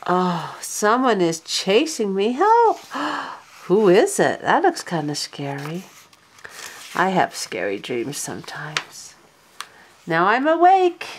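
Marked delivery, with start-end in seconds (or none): 2.3-2.8 high loud pretend panic; playful hammed-up help cry; mock cry for help. Dog chased in dream. 2.8-3.3 gasp. Acted. Scary dream moment. 10.1-11.1 startled waking. Acted. Dog wakes from dream.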